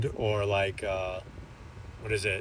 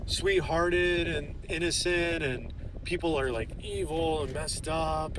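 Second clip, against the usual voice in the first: mocking girls; 6 semitones higher, slower than usual. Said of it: "they're like".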